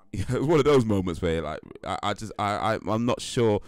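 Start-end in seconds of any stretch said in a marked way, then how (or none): none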